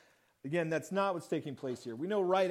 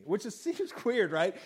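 ordinary talk, nothing marked

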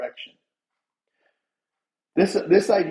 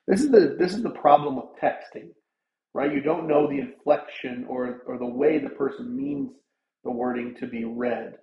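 ordinary delivery

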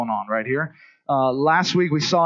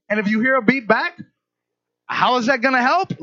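higher-pitched, doubting question; incredulous challenge; 6 semitones higher than usual. Retold or imitated. Doubting passenger questions the taxi driver, incredulous. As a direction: push back on the driver, pitched up in disbelief.

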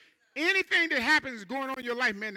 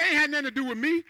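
fervent quote; 6 semitones higher, 8 dB louder, faster than usual. Acted. Voicing Jesus, rising fervour.

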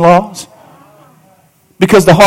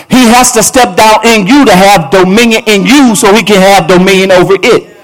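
preaching at full force; 5 semitones higher than usual. Acted. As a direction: drive the point home. Preaching climb with music.

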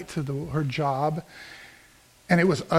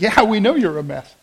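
"Yeah, we know you're a mess" said through a laugh, a bit louder; laughing agreement; 3 semitones higher, 5 dB louder than usual. Retold or imitated. Pastor laughing at the woman's confession, amused. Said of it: "I started laughing about it".

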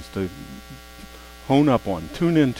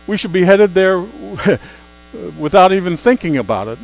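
preaching emphasis; 5 semitones higher, 7 dB louder than usual. Acted. Preaching burst.

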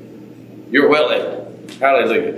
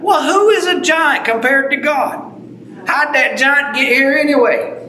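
preaching shout; 9 semitones higher than usual. Real, not acted. Preacher challenges the crowd.

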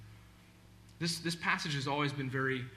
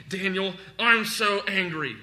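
louder, higher acted venting; mock angry outburst; 4 semitones higher, 8 dB louder than usual. Preacher acting a man venting alone in his room, loud mock anger. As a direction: vent loudly as if imitating an angry man. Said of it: "He's not just sitting in his room by himself".

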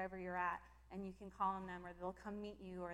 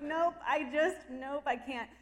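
louder laughing nope; laughing refusal; 5 semitones higher, 10 dB louder, slower than usual. Retold or imitated. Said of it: "I'm like".

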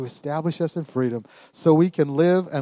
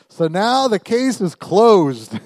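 loud, slow, higher proclamation; emphatic scripture reading; 6 semitones higher, 6 dB louder, much slower than usual. Real, not acted. Preacher reading the Passion Translation, emphatic proclamation. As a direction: announce it like a final verdict. Reads the verse at full force.

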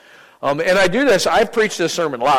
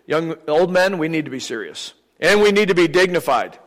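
ordinary talk, nothing marked

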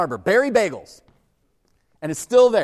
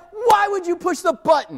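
high, loud exasperated demand; angry boss; 12 semitones higher, 8 dB louder than usual. Retold or imitated. Boss scolding the new hire who triggered the alarm. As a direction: scold him with disbelief.

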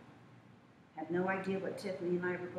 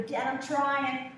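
put-on louder, slow, pleading child; pleading with Dad; 4 semitones higher, 6 dB louder, much slower than usual. Daughter answering her father's sermon, slow and loud. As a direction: drag it out, begging Dad to understand. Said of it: "I go".

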